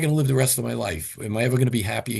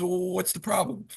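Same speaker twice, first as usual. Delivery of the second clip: IRS agent; 7 semitones higher than usual. Retold or imitated. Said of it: "He goes".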